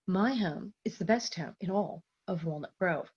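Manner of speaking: soft, cool, deadpan snob; snobby deadpan. Shown as a demonstration. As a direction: recite it coolly with understated snobbery. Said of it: "very deadpan in a way".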